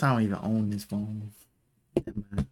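normal talking voice

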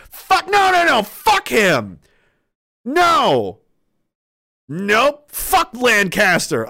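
high, loud angry soldier ranting; furious refusal. Acted. Soldier refusing to take blame for comrade, furious. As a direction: snap back, refusing to take the fall.

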